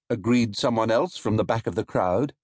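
plain reading voice

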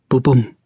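thump it like a heartbeat finally answering; heartbeat sound. A narrator's reading. Narrator voicing the heart starting to beat again. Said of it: "antwortet endlich das Herz".

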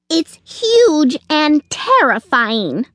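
slow, high, dramatic squirrel; alarmed cry. A narrator's reading. Squirrel describing the monster to Sugar, crying out. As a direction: cry it out, stretching each big word. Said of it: "cried the squirrel".

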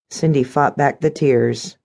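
ordinary delivery

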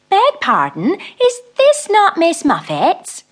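a lightly voiced spider asking his way; polite spider. Big spider politely asks the mouse, slow polite voice.

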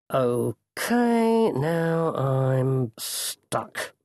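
long drawn-out okay, then very slow; slow, stretched realization of being stuck; stuck and resigned. A narrator's reading. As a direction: drag it out as the predicament sinks in.

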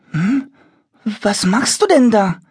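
hohe, erstaunte Kinderstimme; erstaunt. Acted. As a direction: staunend fragen, als traue man den Augen nicht. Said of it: "fragt Moritz erstaunt". (Moritz fragt das Monster erstaunt, hoch und verwundert)

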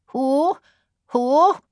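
high, rising owl hoot; surprised owl. A narrator's reading. Owl crying from a sloe tree, surprised high hoot. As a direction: hoot it like a startled owl, pitch sliding up. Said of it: "surprised and trembling".

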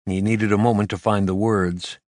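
usual speaking voice

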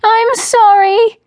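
high, loud, drawn-out apology; slow childish wail, very high; child's wail. A narrator's reading. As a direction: wail it as a sobbing little girl. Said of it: "she wailed".

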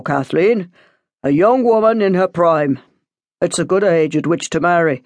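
put-on measured priest addressing a young parishioner; solemn pastoral talking-to; lecturing priest. A narrator's reading.

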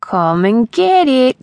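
loud teasing street urchin; taunting. Acted. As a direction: dangle it and dare her, slowly. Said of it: "he said".

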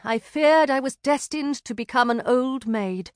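ordinary delivery

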